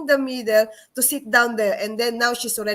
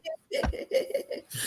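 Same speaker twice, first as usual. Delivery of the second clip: high laugh; 9 dB quieter than usual. Real, not acted. Laughing at own story.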